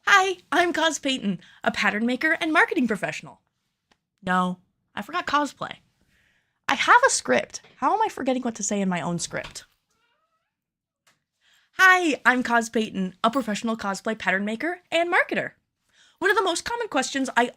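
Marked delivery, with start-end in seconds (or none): 0.0-3.4 bright upbeat host read; cheerful on-camera presenter voice; presenter intro read. Acted. Another retake of intro. 11.8-17.5 bright upbeat host read; cheerful on-camera presenter voice; presenter intro read. Acted. Another retake of intro.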